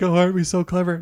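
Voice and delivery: mocking, high and loud; mocking smug voice. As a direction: sneer it like a smug ad. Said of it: "It's like".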